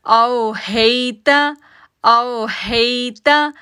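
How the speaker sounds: "आओ हेता" slow, "हे" glided soft; soft ai sound. Shown as a demonstration. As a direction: glide the "हे" gently. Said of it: "नरमी के साथ". (नरमी के साथ "हेता")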